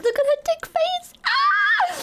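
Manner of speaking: high squeal rising into a scream; hysterical shriek. Real, not acted. Streamer losing it over the cat's face, shrieking. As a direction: lose it, shrieking with hilarity. Cracking up at cat picture.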